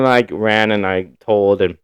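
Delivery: usual speaking voice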